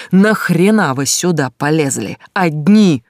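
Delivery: furious yell, slow and loud; angry shout. Acted. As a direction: burst in yelling at him. Vizglyakov scolding Mark. (гневный крик)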